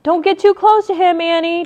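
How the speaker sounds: high, loud warning shout. A narrator's reading. Brother warning sister off the creature, loud shout. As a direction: shout her back from danger. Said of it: "Jack shouted".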